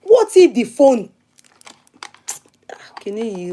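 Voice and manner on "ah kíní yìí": breathy muttered whisper. Real, not acted. Annoyed at the phone, whispering to herself.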